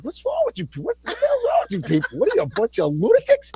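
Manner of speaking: very high, incredulous squeal; mock disbelief. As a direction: climb into a high pitch of exasperated disbelief. Retells confronting crying neighbours.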